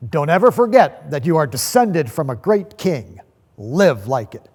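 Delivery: imitating a father's loud, stern lecture; father's lofty speech. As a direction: declaim it like Dad's oft-repeated speech. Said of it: "We called it the Son of David speech".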